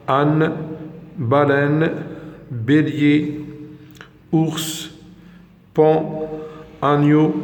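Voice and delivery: each word drawn out for learners; slow review drill. Shown as a demonstration. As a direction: read the list slowly and clearly, like a teacher. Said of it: "je répète la prononciation de ces mots". (نطق بطيء وواضح للمتعلمين)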